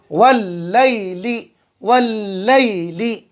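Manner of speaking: वल्लैली drawn out slowly, doubled ल held long; slow Quranic reading. Shown as a demonstration. Teacher reads वल्लैली aloud to students, slow and drawn out. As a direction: stretch वल्लैली syllable by syllable like Quran recitation. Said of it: "इस तरीके से".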